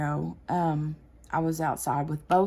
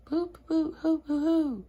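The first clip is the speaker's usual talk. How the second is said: high hooting owl call; hammed-up owl hoots; bad owl impression; 11 semitones higher than usual. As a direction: hoot like a distant owl. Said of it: "the worst impersonation of an owl ever".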